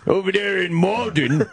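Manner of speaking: mock radio host. Co-host imitating the host's segment after the clip, mock announcer. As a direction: send up a radio host introducing his bit.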